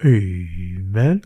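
imitated class response, long and rising; class's drawn-out amen. Whole class answering their teacher, drawn-out amen. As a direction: echo the teacher with a stretched playful amen. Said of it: "the whole class would go".

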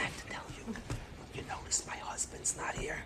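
hushed confiding whisper. Retold or imitated. Mother telling the rabbi her husband is absent, whispering. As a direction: breathe it like a secret. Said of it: "she started whispering".

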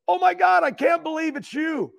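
high-pitched amazed fan voice; imitated starstruck kid. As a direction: gush like a kid meeting his hero. Retold starstruck kid meets him.

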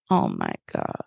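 real hushed whisper. Real, not acted. Host reacts to the show's scheming in a hushed whisper. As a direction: breathe it out with no voice.

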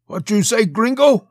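high, loud challenging barkeep; barkeep's challenge. A narrator's reading. Plump Mexican barkeep leans over the bar, challenging Rigdon loudly. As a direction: fire the question out high and loud.

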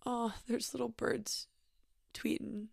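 ordinary delivery

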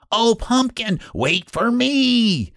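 high, pleading wail; high, drawn-out plaintive cry. A narrator's reading. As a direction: call after a friend, stretching "wait for me". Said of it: "he cried".

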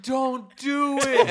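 slow, high, loud pleading; desperate drawn-out don't; panicked plea. Retold or imitated. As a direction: stretch it out begging. Begging friend not to stomp.

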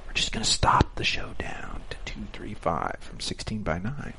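unvoiced whisper of the big reveal; conspiratorial whisper; whispered pitch. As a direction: lean in and whisper the plan. Pitching a secret plan.

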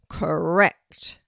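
loud, resounding verdict; booming tree teacher. A narrator's reading. Oak tree accepts Kelty's answer, booming. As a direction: boom it out as the oak. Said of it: "responded the teacher of the oak tree".

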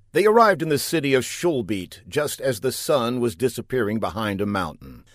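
ordinary delivery, nothing marked

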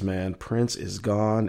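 normal talking voice

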